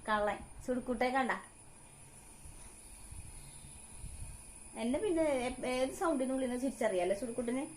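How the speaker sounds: high sing-song coaxing of a little one; baby talk. Acted. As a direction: coax the baby, high and bright. Mother talking to her baby. (കുഞ്ഞിനോട് കൊഞ്ചി സംസാരിക്കുന്നു)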